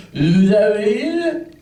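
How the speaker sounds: put-on high, slow, slurred drunk mumbling; slurred drunk babble. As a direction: babble like a man too drunk to form words. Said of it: "I'm basically going".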